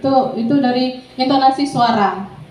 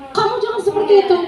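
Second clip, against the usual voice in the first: high, quick scolding, lightly acted; a hint of a telling-off teacher; punisher; 8 semitones higher, much faster than usual. Punishing teacher warns a student, mild quick scolding. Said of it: "Penghukum sih sering".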